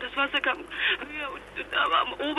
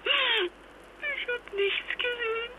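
drawn out, much higher and softer, voice cracking; slow, high and hushed, on the edge of tears; broken, slow and high; 8 semitones higher, 4 dB quieter, much slower than usual. Acted. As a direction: barely hold yourself together. Traumatised mother recounting loss.

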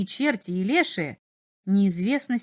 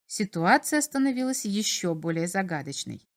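plain reading voice

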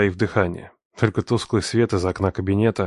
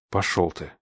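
much softer, lower breathed reply; exhaled; 3 semitones lower, 6 dB quieter than usual. A narrator's reading. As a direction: breathe it out staring him down. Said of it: "выдохнул в ответ".

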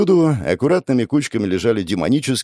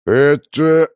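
loud mumbled monster voice; mumbling boss; 5 semitones higher, 6 dB louder than usual. Acted. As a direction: mumble it like a big beast. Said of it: "бубнил босс".

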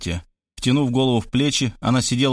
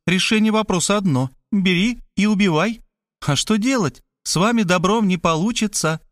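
high-pitched, insistent woman's voice; matter-of-fact, needling woman; argumentative woman; 7 semitones higher than usual. Acted. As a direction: press the case bluntly in a lifted voice. Voices Мархалёва arguing with Robert.

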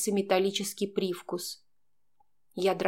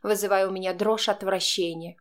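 ordinary delivery

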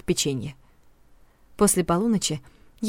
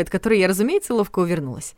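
plain reading voice

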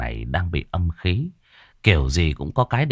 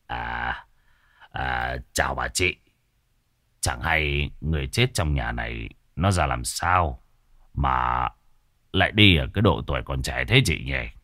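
lower, softer oily greeting; butter her up gently, lower; smarmy old man; 7 semitones lower, 4 dB quieter than usual. A narrator's reading. Sorcerer sweet-talks a gossipy village woman, lower, softer oily voice.

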